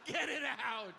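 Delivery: high, squealed plea through laughter; helpless laughing. Retold or imitated. Patient pleading with doctor mid-exam, laughing helplessly. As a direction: beg through hammed-up hysterical giggles. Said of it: "Well, stop laughing".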